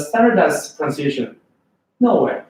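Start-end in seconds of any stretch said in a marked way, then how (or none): none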